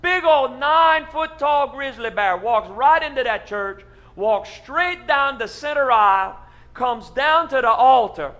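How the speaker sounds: loud, high, building preacher excitement; excited tall tale. Acted. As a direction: tell it big and breathless. Pastor tells wild tall tale.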